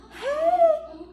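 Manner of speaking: squealed over-the-top Hey with a laugh; cringey too-much Hey; overdone greeting. Shown as a demonstration. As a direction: greet way too eagerly, almost giggling. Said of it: "That sounds terrible".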